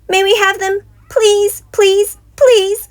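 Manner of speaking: high, loud begging; pleading shout. A narrator's reading. Cubs shouting at Mama for the toys. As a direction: beg at the top of their voice. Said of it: "they shouted".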